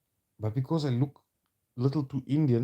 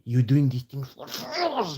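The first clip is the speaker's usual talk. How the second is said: slow, higher mock accusation; mocking imitation; 4 semitones higher, 3 dB louder, slower than usual. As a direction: stretch it out, pitched up in mockery. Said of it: "they told me I'm".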